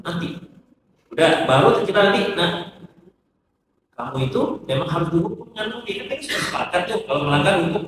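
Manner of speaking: a hushed, reassuring parent giving a calm punishment; calm mother. Shown as a demonstration. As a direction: speak to the child gently, holding back anger. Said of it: "tenang dulu".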